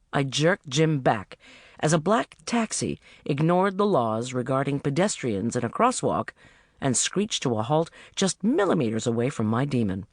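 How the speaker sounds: plain reading voice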